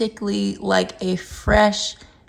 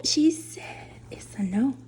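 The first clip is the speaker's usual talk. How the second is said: soft whisper about the dog; whispered aside; 8 dB quieter than usual. Real, not acted. Owner whispering that the dog refused the cookie. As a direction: breathe it out as a little joke.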